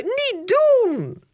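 high, loud protest; yelling protest. Acted. Girl yells at brother to stop spraying, loud. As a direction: shout for him to stop. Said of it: "brult Janneke".

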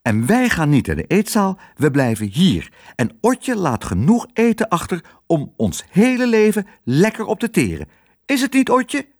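high, emphatic mouse aunt laying down the law; quarrelsome retort. A narrator's reading. Aunt mouse argues back in quarrel. (hoog en bazig ruziënd)

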